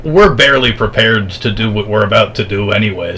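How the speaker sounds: usual speaking voice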